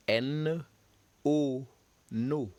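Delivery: N, A, "na" spelled then said as a syllable; French syllable drill. Shown as a demonstration. Teacher models French syllables for students to repeat. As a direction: sound out N, A and then "na" slowly for the class. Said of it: "Repeat after me".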